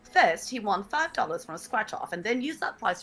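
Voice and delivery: put-on upper-class English accent; Downton Abbey English accent. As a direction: read it in a period-drama English accent. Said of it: "Downton Abbey".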